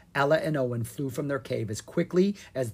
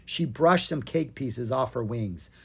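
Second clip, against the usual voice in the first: ordinary delivery, nothing marked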